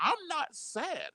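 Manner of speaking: high and very slow, scornful; mocking retort. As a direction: draw it out in disbelief. Retorting to Trump's video.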